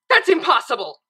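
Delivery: loud, high scream of disbelief. A narrator's reading. Twilight screaming at Pinkie's impossible answer. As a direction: yell it at the board. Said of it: "Twilight screamed".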